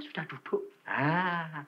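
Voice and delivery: ordinary delivery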